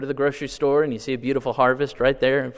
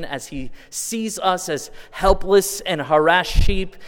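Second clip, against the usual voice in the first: raised, impassioned gospel appeal; louder preaching with long stretched words; fervent preaching; 3 semitones higher, 5 dB louder than usual. Acted. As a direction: press the call home to the crowd. Preaching gospel call at full force.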